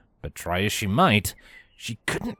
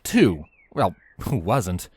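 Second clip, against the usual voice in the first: usual speaking voice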